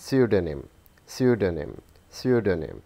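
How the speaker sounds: "pseudonym" with a silent p, SOO-do-nim; correct pronunciation. Shown as a demonstration. Teacher shows students the silent p. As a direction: start "pseudonym" on the s. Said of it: "the correct pronunciation is".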